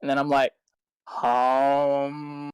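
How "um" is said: long, low droning "om"; mock meditative drone; meditation chant. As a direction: hum it low and drawn out like a meditator. Imitates chanting during meditation.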